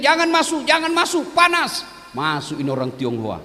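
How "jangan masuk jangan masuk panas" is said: higher, urgent warning shout; half-hearted yell telling the man to keep out; mock yell. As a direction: warn them off in a mock panic. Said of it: "Dia teriak".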